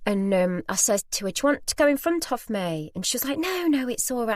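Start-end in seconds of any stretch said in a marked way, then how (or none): 1.3-2.9 lightly acted high-pitched offer; polite offer. Shopper offering lady her place in the queue, politely. 3.4-4.3 high-pitched polite protest; polite refusal. Retold or imitated. Lady declining a place in the queue, politely insistent.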